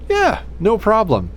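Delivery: bubbly server brushing it off; waitress voice. Retold or imitated. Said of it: "Waitress comes over".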